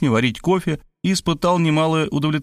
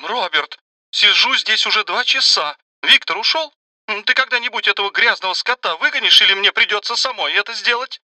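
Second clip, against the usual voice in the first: irritated; 10 semitones higher than usual. A narrator's reading. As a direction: snap it, fed up with waiting. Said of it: "раздражённый голос Марии".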